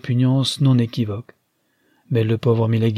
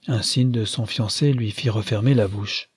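ordinary delivery

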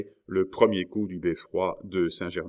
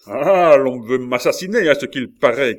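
loud, raised outcry; indignant shout; 6 semitones higher, 11 dB louder than usual. A narrator's reading. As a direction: cry out at would-be killers. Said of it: "cria la Mole". (cri indigné, fort et haut)

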